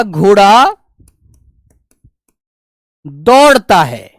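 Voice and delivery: slow, word by word while writing on the board; slow dictation. Shown as a demonstration. Teacher writing the example for students, slow dictating voice. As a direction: drag it out as if dictating. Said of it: "जैसे एक उदाहरण मैंने लिखा".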